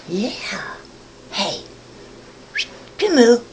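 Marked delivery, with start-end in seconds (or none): 0.1-1.6 soft unvoiced whisper to a pet. Real, not acted. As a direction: breathe it to the dog. Cooing at pet.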